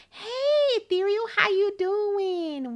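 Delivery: high, drawn-out happy hello; excited greeting. Real, not acted. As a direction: welcome a friend with a big, high hey. Streamer greets an arriving viewer.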